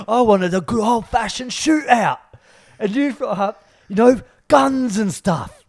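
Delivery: put-on high, loud London gangster accent; shouted British gangster outrage, hammed up; Ronnie Kray. Player finishing the scene from Legend, shouted London gangster accent. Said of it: "are you doing Ronnie Kray here".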